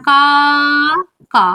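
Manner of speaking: কা, then কা, with a pause between; syllable split. Shown as a demonstration. Teacher counting syllables of কাকা aloud. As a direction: say কাকা as two beats, কা and কা. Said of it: "কটা দল আছে".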